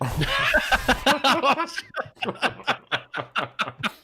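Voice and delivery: big high laugh; loud burst of laughter. Real, not acted. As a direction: crack up loudly. Laughs at awkward pause.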